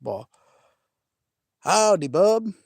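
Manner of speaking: ordinary talk, nothing marked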